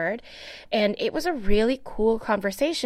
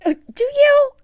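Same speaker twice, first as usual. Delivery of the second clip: surprised; 10 dB louder than usual. Real, not acted. Touched by friend's compliment.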